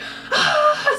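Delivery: high, breathy mock scream; imitated scream. Woman in the corner screaming for her life, mock scream. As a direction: fake a scream without full volume. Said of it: "screaming like that".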